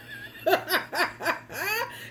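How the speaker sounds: high giggling laugh; a quick high real laugh. Real, not acted. Vlogger laughing at her own joke, as a high giggle. Laughs after honey baby.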